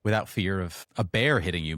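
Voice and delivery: normal talking voice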